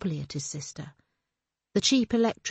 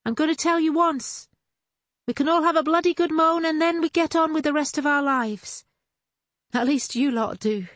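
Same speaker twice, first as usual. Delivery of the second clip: raised much higher and louder; calling after him; 9 semitones higher, 5 dB louder than usual. A narrator's reading. Sister calls after her walking-away brother, loud and high. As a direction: shout it after him, half teasing. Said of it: "Her voice reached him".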